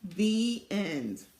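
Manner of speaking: ordinary delivery, nothing marked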